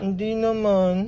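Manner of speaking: soft, slow sobbing; mock tearful denial. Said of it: "Bakit umiiyak ka?".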